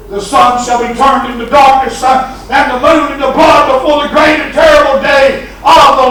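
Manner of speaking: shouted, high, rhythmic preaching with "uh" breaths; full-force preaching. Acted. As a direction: preach it out at the top of the voice.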